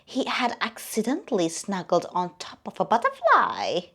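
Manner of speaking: ordinary delivery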